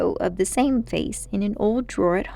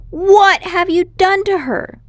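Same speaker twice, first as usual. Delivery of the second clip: put-on higher, louder, slower boy's demand; alarmed boy; 10 semitones higher, 8 dB louder than usual. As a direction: press him for an answer.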